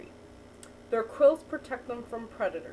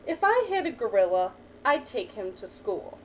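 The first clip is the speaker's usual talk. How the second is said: put-on reading to a child; 3 semitones lower than usual. Teacher reading a picture book to a child, lively storytelling. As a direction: read the picture book to the child, lifting each new animal.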